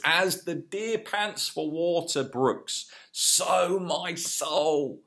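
slow, lifted reading of the psalm; reverent scripture reading. Retold or imitated. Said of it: "he says".

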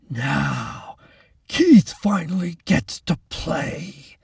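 slow, creepy anticipation; sinister relish. A narrator's reading. Keith about to hurt Lacey, sinister. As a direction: savour each word.

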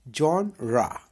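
जॉनरा said jawn-ra with ra at the end; जॉनरा, ending on a clear ra; correct genre. Shown as a demonstration. Teacher models जॉनरा, ra ending. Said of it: "रा आखिर में लगेगा".

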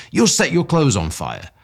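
high, loud warning; cried out. A narrator's reading. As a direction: call out a warning to a running man. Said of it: "I cried".

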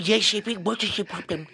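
put-on children's puppet voice; George. Impressionist as George asking Zippy the problem, put-on voice. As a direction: lift it into a strange cartoon puppet voice. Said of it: "George or Zippy?".

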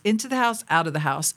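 ordinary talk

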